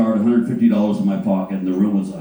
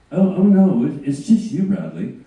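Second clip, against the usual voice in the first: higher, reassuring mock reply; lightly higher woman's voice; Sharon; 6 semitones higher than usual. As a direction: imitate her amused denial. Voicing Sharon.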